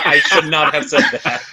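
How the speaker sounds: laughing through embarrassed words. Real, not acted. Host laughs at his own Gouda pun. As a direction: giggle while regretting the joke. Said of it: "Oh, that's great".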